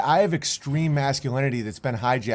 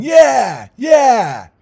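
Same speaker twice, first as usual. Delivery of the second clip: high shouted crowd cheer, much louder; 10 semitones higher, 12 dB louder than usual. Retold or imitated. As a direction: whoop like a rowdy fight crowd. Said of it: "the demons are the crowd going".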